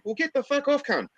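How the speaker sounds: high squeaky put-down; mock high retort. Mocking the commenter's retort, high squeak.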